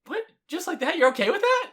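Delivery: high, stunned disbelief; can't believe the partner agreed; hurt disbelief. Acted. Partner shocked the other would end it, stunned disbelief.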